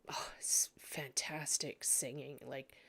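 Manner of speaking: breathless awed whisper; hushed, awed whisper. Real, not acted. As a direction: breathe it out in wonder. Awed aside about angel choir.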